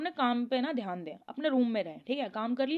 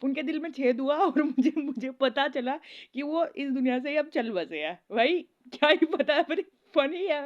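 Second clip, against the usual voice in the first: louder, words riding on laughter; laughing while talking; 4 dB louder than usual. Real, not acted. Laughing to viewers about karma's twist. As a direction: admit it's funny while still laughing. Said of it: "मुझे हंसी आ रही है ना".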